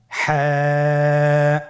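হ্যাঁ from mid-throat, held long; throaty ha. Shown as a demonstration. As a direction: squeeze the throat and draw হ্যাঁ out. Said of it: "গলা চিপে ধরে আপনি উচ্চারণ করুন". (গলা চিপে হ্যাঁ লম্বা টেনে)

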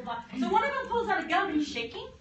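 normal talking voice

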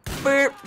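sudden loud, high blurted boop; short, high comic blast noise; playful shotgun noise. Pretend. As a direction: pop out a mock gun blast.

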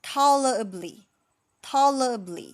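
"tolerably" with loud TALL, falling ler-uh-blee; corrected ending. Shown as a demonstration. Teacher repeats the corrected word, corrected ending. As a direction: drill "tolerably" twice, clearly ending in lee. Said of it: "อันนี้มันเป็น ly ใช่ไหม".

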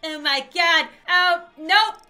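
loud, half-laughing alarm. Real, not acted. Player fleeing the ghost lady, loud alarm. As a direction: cry out loudly in a panic. Fleeing after jump scare.

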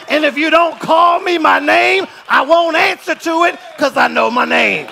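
loud, impassioned sermon shout; full-force preaching. Real, not acted. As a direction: build to a proud, defiant declaration from the pulpit.

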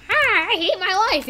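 hammed-up high outburst; acted wail. Figure beaten with a stick, high mock wail.

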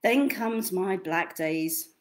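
lively storybook read with big swings; reading to children. Acted. Reads picture book aloud.

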